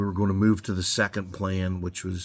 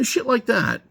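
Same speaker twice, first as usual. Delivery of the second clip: usual speaking voice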